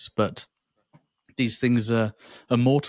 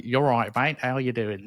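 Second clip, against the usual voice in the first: light Cockney greeting, lightly put on; a hint of a cheery London Cockney; Cockney accent. Grandson quoting his Cockney granddad's note, light Cockney accent. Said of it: "he was Cockney".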